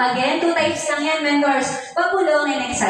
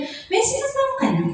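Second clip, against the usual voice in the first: higher, light teasing question to a client; playful joking host; 11 semitones higher than usual. Pretend. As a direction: tease the client to break the ice. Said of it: "Joke ka din".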